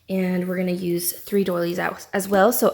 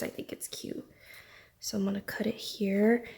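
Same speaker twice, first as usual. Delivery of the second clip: soft whisper; 8 dB quieter than usual. Real, not acted. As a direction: whisper it to yourself. Quiet crafting aside, unvoiced.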